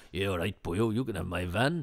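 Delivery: a lightly voiced grumpy Welsh-ish milkman; grudging milkman giving up his van, lightly put on; a hint of a surly milkman handing over the van. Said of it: "I can't see the surly milkman going".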